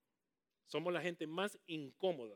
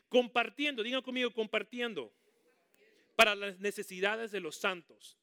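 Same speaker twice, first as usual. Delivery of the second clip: loud, higher call for the church to repeat; loud preaching call; 4 semitones higher, 9 dB louder than usual. Real, not acted. Preacher leading the church in a verse, loud. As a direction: belt it out to the congregation. Preacher calls out Bible word.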